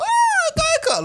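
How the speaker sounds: high, drawn-out mock yell; mock scream. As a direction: squeal it out in a high screech. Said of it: "we were screaming our arse off".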